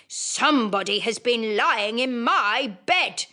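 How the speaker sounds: slow, rough big bear rumble; great gruff bear voice. Acted. Great bear finds his chair disturbed, slow gruff rumble. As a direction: growl it slowly like a huge bear. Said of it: "in his great rough, gruff voice".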